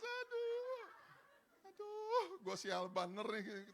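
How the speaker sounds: high, loud hammed-up wail; mock crying. Preacher imitating himself crying over his lost motorbike, mock wailing. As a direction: bawl comically, slowing into self-pity. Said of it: "cuma saya nangis".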